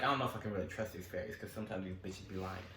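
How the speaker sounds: suspicious inner thought. Retold or imitated. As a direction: think it privately, doubtful.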